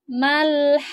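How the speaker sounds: Mal-haqqah with long vowel then pressed qaf; over enunciating. Shown as a demonstration. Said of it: "huruf qafnya ini ditekan".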